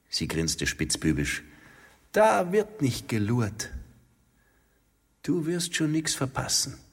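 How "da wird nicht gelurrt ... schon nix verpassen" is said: higher, teasing woman's voice; lightly voiced roguish woman; playful woman. A narrator's reading. She teases him, grinning mischievously, in a higher playful voice.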